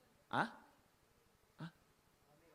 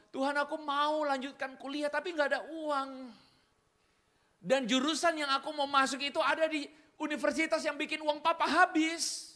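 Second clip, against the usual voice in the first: put-on higher, louder pleading girl; higher girl's plea; praying girl; 9 semitones higher, 5 dB louder than usual. Preacher voices praying girl.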